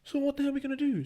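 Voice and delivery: high, fast panicked question; stuck by the lion, asking in panic; panicked pitch-up. Retold or imitated. Panicked retold quote.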